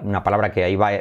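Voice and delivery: ordinary talk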